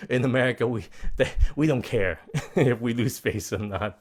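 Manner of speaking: laughing through the words; amused. Real, not acted. Joking aside with laughter.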